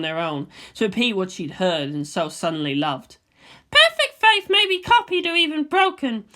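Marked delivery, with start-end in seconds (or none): 3.7-6.2 put-on higher, louder girl's voice for Asuka; a young girl arguing her point; Asuka's voice. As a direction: raise the voice to play the headstrong girl. Asuka's voice again.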